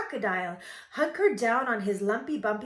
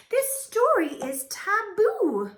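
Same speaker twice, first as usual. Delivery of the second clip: high, loud, slow pronouncement; mama's loud, stretched-out ban; emphatic scolding; 12 semitones higher, 7 dB louder, slower than usual. A narrator's reading. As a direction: declare it forbidden, drawn out and high. Mama forbids the story.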